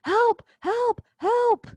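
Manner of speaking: high-pitched, loud cries of help; hammed-up yelling for help from behind the curtain; mock yell for help. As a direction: Polonius squealing help, help. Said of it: "starts yelling".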